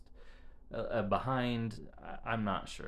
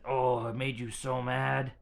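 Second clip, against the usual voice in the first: put-on slow, louder sympathetic reply to a child; empathetic, slow; 5 semitones higher, 8 dB louder, slower than usual. Mother sympathizing with her angry son, slow and louder. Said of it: "And I said".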